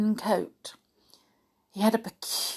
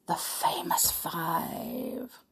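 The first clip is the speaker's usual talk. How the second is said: slow, hushed title reveal; dramatic whisper; 3 semitones lower, 9 dB quieter, much slower than usual. Pretend. Storyteller teases the book title in a slow whisper. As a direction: breathe the title like a secret.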